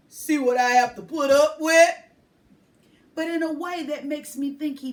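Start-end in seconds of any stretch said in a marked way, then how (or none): 0.1-1.9 louder mock-weary man; amused man. A narrator's reading. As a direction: sigh it out jokingly. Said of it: "as if to say".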